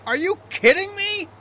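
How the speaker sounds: high, loud disbelief; can't believe what he hears; exasperated. Acted. Skipper exasperated by crying.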